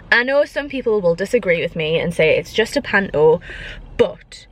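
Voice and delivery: ordinary talk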